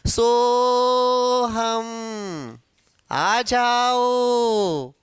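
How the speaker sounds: सो हम आ जाओ with every vowel hugely stretched, very slow; sing-song each syllable out long like old-time folk; drawn-out old speech. Retold or imitated. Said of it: "बोलने का तरीका कैसा था उसका?". (पुराने ज़माने की तरह झुला-झुलाकर खींचकर)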